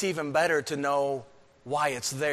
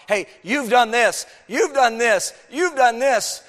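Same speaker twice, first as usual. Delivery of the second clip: higher, louder pointed accusation; accusing prophet; 8 semitones higher, 9 dB louder than usual. Retold or imitated. Prophet accusing the people of their sins, loud. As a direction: jab each charge home. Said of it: "pointing out".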